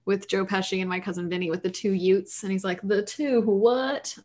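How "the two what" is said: slow, stretched hammy Southern drawl on the two what; lightly hammed Southern accent, like a small-town judge. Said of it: "really hammy Southern accent".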